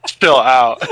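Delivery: chill out through a laugh; loud, laughing protest. Real, not acted. Friend laughing off a crude joke, loud laughing protest. Teasing over Yoshi joke.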